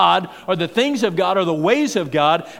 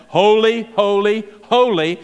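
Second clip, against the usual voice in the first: ringing proclamation; 3 semitones higher, 4 dB louder than usual. Acted. Seraphim crying holy three times, loud. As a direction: call out each holy like a proclamation. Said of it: "twice, but three times".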